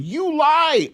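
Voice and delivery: high, sharp mock heckle; Joe Wilson heckle. Quoting the congressman heckling the president, mock yell. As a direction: fling it out like a heckler. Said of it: "those Joe Wilson".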